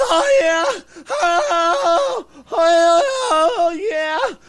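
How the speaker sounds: loud, high-pitched mock moans; fake moaning. Mimicking the noisy neighbor faking it, loud theatrical moaning. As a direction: wail out badly faked cries of pleasure. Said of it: "you could tell she was faking it".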